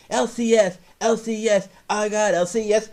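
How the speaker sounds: put-on high, loud chanting of a kid's boast; mock kid boasting. Mimicking the kid who only talks about his condition. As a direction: parrot a kid who won't shut up. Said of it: "And all this".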